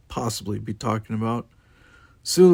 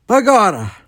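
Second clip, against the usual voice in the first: very high, loud crowed exclamation; crowing; 12 semitones higher, 11 dB louder than usual. A narrator's reading. Chekhov from a smoking console, crowing. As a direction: crow it out with relish. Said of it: "Chekhov crowed from somewhere inside a plume".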